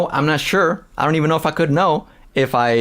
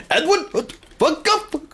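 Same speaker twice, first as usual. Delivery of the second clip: mocking angry mod; 11 semitones higher than usual. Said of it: "the other guy was even worse".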